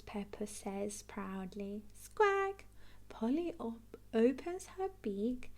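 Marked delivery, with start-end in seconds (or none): 1.9-2.6 parrot squawk. Acted.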